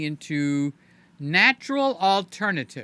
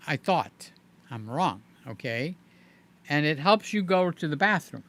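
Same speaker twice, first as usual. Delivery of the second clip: ordinary delivery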